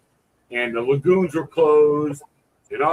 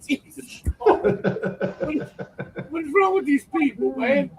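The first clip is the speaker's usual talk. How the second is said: laughing disbelief; 10 semitones higher, 4 dB quieter than usual. Retold or imitated. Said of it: "I'm like".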